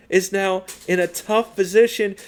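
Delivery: high, loud, breathless play-by-play; excited hype call. Real, not acted. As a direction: call the comeback like the crowd's on its feet. Climax of close match call.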